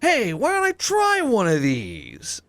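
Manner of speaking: imitating a guy in a high, slow, dopey voice; goofy quote. Retelling a skit, mimics the guy high and slow. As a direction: play the clueless guy trying something, drawn out. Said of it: "He's like".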